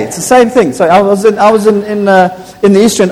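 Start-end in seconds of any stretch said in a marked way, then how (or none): none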